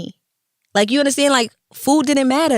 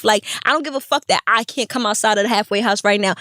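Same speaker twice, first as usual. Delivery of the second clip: normal talking voice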